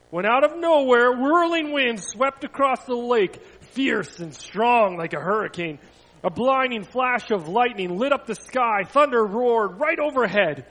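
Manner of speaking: loud, swelling storyteller's drama; dramatic storm narration. A narrator's reading. Narrator tells children of a sudden storm, loud and dramatic. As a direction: tell of a storm striking out of nowhere.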